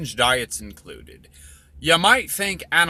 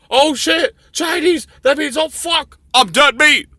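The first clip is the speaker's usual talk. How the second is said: much higher, louder cartoon dog panic; panicked dog voice; 13 semitones higher, 7 dB louder than usual. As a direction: squeal it like a doomed cartoon dog. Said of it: "The dog ... is sitting there thinking".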